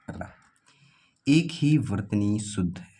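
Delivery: usual speaking voice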